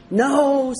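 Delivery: a loud high No from the proud father; emphatic no. A narrator's reading. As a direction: blurt out No, almost offended.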